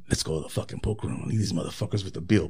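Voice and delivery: low conspiratorial scheming; low, quick plan to ditch the bill; sly suggestion. Retold or imitated. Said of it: "He's like".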